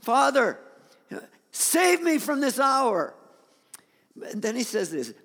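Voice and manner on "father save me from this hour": slow, loud, high cry to Father; anguished plea. Acted. Jesus feels the heaviness and calls to God, anguished plea. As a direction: call out slowly, pleading for rescue. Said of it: "what is going on with this man".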